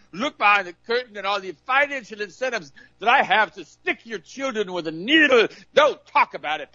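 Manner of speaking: mock arrogant doctor. Preacher mocks a doctor banning the patient, high haughty voice. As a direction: lord it over the peasant patient. Mocking doctor hiding money.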